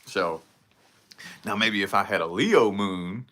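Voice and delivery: ordinary delivery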